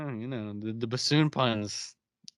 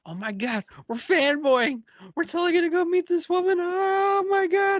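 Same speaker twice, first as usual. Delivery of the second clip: much higher, fast and gushing; giddy fanboy excitement; 5 dB louder than usual. Retold or imitated. The group in the elevator about to meet their idol, giddy high gushing. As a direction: squeal it like starstruck fans. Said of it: "they're getting all excited".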